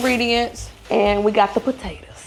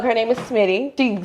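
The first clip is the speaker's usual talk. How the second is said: ordinary talk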